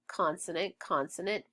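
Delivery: ordinary talk, nothing marked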